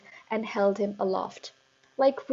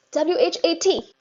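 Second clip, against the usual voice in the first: much higher, loud squeal; 8 semitones higher, 7 dB louder than usual. A narrator's reading. Eric dangling by the ears, high squeal. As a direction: squeal the letters. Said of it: "he squealed".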